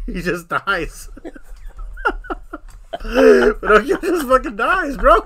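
laughing hard, voice rising high and loud; cracking up while telling it, slow. Real, not acted. Friend laughing while telling a sad movie ending.

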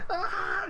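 high imitated crying wail; mock crying wail. Recruit breaking down under pressure, high mock crying wail. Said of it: "he starts crying".

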